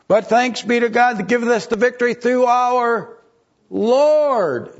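high, loud, swelling sermon cry; preaching at full force. Acted. Preacher rallying his flock, rising to a loud high cry. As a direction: lift the congregation toward the victory. Second reading of the verse.

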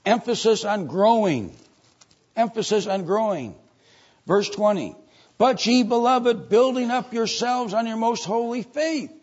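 high, loud pulpit proclamation; forceful scripture preaching. Real, not acted. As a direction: ring out the verse to the congregation. Preaches and reads scripture emphatically.

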